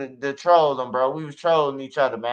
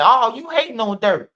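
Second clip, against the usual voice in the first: high, loud mocking whine of the critics; mocking accusers; 7 semitones higher, 5 dB louder than usual. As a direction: parrot the haters with a sneer, pitched way up. Mocking critics who accuse him.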